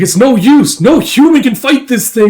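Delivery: high, loud despairing alarm; panicked cry. Acted. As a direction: act a soldier losing hope in battle. Soldiers panic before monster.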